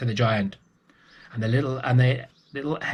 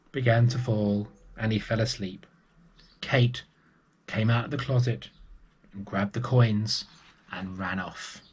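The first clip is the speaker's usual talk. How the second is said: usual speaking voice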